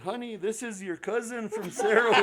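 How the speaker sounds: husband laughing as he tells his wife the news; excited announcement. Retold or imitated. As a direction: call out to the wife, delighted.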